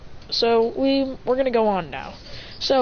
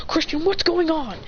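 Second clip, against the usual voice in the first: higher, alarmed question; pitched-up urgent question; demanding answers; 7 semitones higher than usual. Acted. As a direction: call out to a student in mock alarm. Acted teacher demanding answers.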